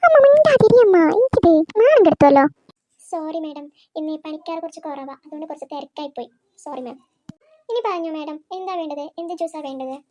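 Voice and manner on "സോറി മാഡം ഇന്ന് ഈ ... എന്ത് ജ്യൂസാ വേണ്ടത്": lower, much softer apologetic server; meek waiter. Acted. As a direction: mumble apologies like a flustered waiter.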